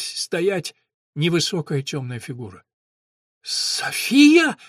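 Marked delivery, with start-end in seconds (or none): 3.4-4.6 high, loud cry that cracks; voice cracking. A narrator's reading. Dan seeing Sofia, his voice cracking with relief. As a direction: let the voice break on the name. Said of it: "Голос у парня дал петуха".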